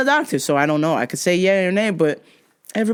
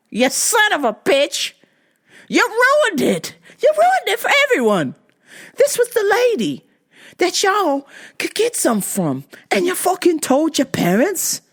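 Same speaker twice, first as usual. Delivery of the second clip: high-pitched comic scolding; hammed-up aggrieved rant; mock outrage; 9 semitones higher than usual. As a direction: berate the tattletale in exaggerated indignation. Mock scolding of boy who told.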